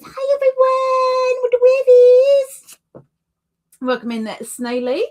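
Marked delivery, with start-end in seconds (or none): 0.1-2.8 very high, drawn-out mock squeal; hammed-up viewer greeting. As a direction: read the chat greeting in a high, held, sing-out voice. Reading a viewer's chat greeting aloud.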